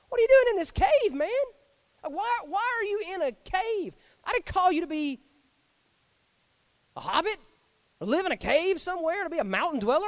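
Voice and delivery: high, pleading, half-amused God calling a prophet out; exasperated God. Acted. God calling Elijah out of his cave, exasperated. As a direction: shake him out of the cave with disbelief. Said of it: "I wonder if he said it like this".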